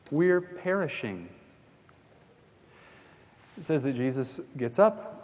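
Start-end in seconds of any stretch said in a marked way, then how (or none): 0.1-1.3 higher, slow cry of panic; slow panicked plea. Retold or imitated. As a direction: stretch it out in dread. Disciples crying out in the storm.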